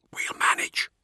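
firm unvoiced whisper; whispered reply. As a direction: whisper it with resolve.

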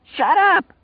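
very high, loud furious scream; shrieking shut up. Acted. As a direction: scream him down. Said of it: "Lloyd screamed".

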